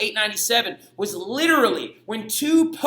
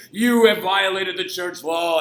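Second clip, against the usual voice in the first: lightly voiced stern pope, slow and pompous; pompous accuser; 3 semitones lower, slower than usual. Pope Stephen charging a corpse, mock-solemn and slow. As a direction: intone the charge like a self-important judge.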